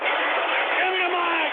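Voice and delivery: slow drawn-out shouted demand; hammed-up yell for the mic; shouting demand. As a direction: bellow for the mic. Yelling for the mic.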